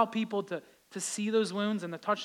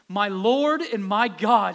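slow, loud exclamation; exclaimed; 2 semitones higher, 10 dB louder, slower than usual. Acted. Thomas meeting Jesus, slow loud exclamation. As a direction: declare it in awe, drawn out. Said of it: "he exclaimed".

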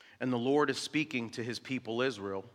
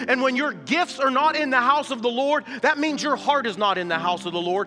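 loud, high, ringing preacher's voice; full-force preaching; 12 semitones higher, 11 dB louder than usual. Acted. Pastor to his congregation on giving, preaching at full force. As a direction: proclaim God's word with rising fire.

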